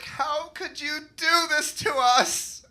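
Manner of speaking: high, slow, wounded mom; a hurt mother's reproach. Retold or imitated. Mom scolding her son over the counterfeiting, high and hurt. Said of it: "My mom's like".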